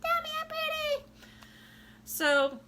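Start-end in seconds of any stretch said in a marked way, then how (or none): 0.0-1.1 very high, louder, squeaky mock voice; mock a vain girl fishing for compliments; shallow airhead. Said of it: "I'm not gonna be like".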